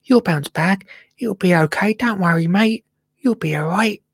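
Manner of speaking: gentle high encouragement, mate; reassuring a plant. Pretend. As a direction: coax it like a poorly pet. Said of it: "but I'm just thinking".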